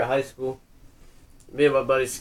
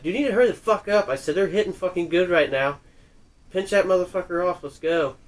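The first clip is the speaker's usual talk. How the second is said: fast raised mock yell; retold yell; 6 semitones higher, 3 dB louder than usual. A retold yell at a buddy who is taking too long. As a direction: hurry him along impatiently, retold. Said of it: "I was yelling for him".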